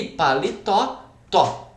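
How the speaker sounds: paletó said slowly, final TÓ held, then repeated alone; stress on last syllable. Shown as a demonstration. Teacher pointing out the stressed syllable, last-syllable stress. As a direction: make the TÓ of paletó stand out. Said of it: "Qual é a sílaba que se sobressai?".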